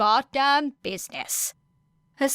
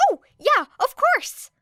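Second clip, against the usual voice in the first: much higher, louder burst of eagerness; eager Midoriya; 6 dB louder than usual. A narrator's reading. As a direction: jump at the chance to explain.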